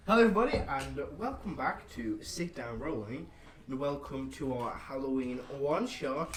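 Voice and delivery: bright, slowed show opener; host intro voice. Acted. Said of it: "Might wanna restart that one".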